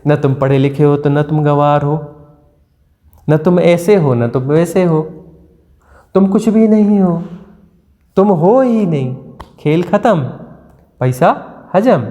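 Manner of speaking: ordinary talk, nothing marked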